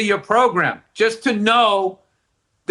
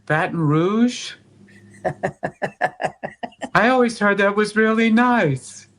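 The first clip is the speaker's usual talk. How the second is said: pouting wife; 3 semitones higher than usual. Retold or imitated. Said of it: "They pout first".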